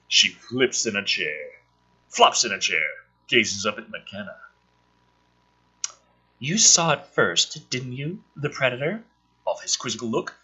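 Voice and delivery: low, deadpan script read; screenplay reading. Acted. Reading the script aloud for viewers, low and deadpan. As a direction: read the scene out dry and low.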